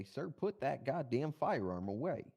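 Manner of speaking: slow, firm officer order; police command. Pretend. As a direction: order him slowly and firmly. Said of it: "I'm gonna say".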